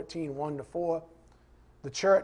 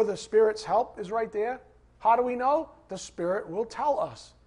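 ordinary talk

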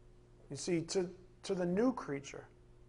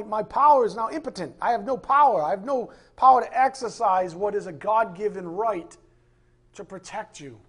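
impassioned; 4 semitones higher, 7 dB louder than usual. Real, not acted. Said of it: "It gets me so mad".

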